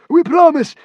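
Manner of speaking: loud, high, desperate consul; frightened promise. A narrator's reading. The terrified consul promising the god. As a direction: blurt the promise in fear. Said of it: "said the consul".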